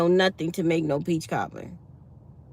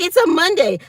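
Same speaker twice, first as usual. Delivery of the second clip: higher, louder mock complaint; a hint of a dry supervisor; 10 semitones higher, 7 dB louder than usual. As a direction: say Monday like it's a chore. Supervisor's reply.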